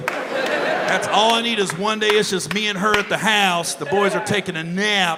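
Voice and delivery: usual speaking voice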